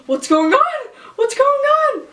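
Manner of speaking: high, loud, rising alarm; retold mother-in-law's startled cry; imitated alarmed questioning. Worried mother-in-law woken at night.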